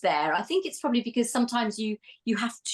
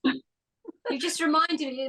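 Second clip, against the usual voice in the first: ordinary talk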